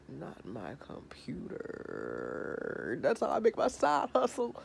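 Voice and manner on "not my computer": slow, much softer whisper of dismay; whispered horror. Retold or imitated. Pedro reacting to his computer news, slow stunned whisper. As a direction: breathe it out slowly in shock.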